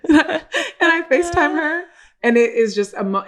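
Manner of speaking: laugh-crying. Real, not acted. Emotional retelling, laugh, cry.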